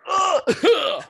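sudden high yelped "ugh"; startled flinch noise, loud; flinching grunt. Retold or imitated. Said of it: "He's just like".

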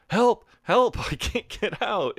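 high mock plea for help, half laughing; mock cry for help. Superhands stuck behind the bathroom door, mock high cry for help. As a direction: squeal for help in a hammed-up high voice.

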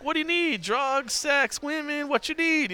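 high-pitched imitation of an insistent vendor; pushy hustler. Bar woman interrupting the friends' chat with offers, pushy.

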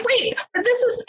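high, bewildered cut-off; baffled mom. Retold or imitated. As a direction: stop short, completely lost. Mom baffled by online friend.

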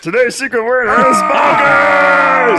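high, slow, screaming announcement; Pee-wee secret word shout. Acted. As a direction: yell it like Pee-wee's Playhouse.

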